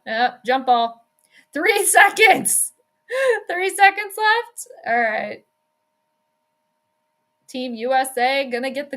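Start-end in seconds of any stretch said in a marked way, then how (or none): 1.5-4.7 laughing excitedly. Real, not acted. Laughing at the clock.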